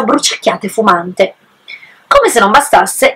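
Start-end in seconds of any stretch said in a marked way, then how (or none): none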